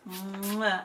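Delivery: a playful smacked air kiss; kiss sound. Pretend. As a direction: blow a little kiss to the love interest. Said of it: "I have to do a kissy".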